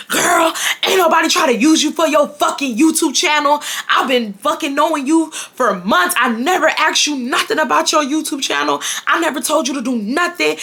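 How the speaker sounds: high, heated mock rant of a furious boyfriend; mock angry boyfriend on the phone; imitating angry ex. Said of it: "This is how he's talking to me".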